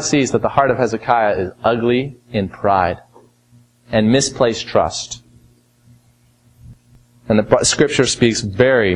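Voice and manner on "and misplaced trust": slow, low, misplaced weighted; misplaced pressed hard, trust set down slowly; stress on misplaced. Acted. Slow emphatic phrase.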